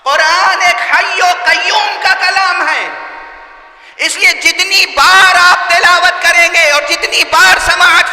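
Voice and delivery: high, loud oratory with slow, drawn-out words; full-force preaching. Real, not acted. Preacher declaring the Quran living speech to the congregation, full-force preaching. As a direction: build to a ringing pulpit proclamation.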